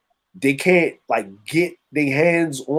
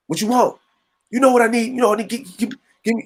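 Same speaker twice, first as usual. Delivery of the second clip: mock store exchange; 7 semitones higher, faster than usual.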